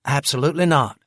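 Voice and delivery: firm, loud refusal; forceful. A narrator's reading. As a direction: shut it down hard. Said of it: "Ben replied forcefully".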